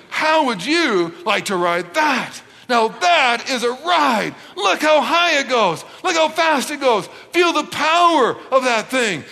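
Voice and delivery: grand, punchy salesman's build-up; hyping it up. Acted. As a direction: sell the thrill word by word. Dad hyping the coaster to kids.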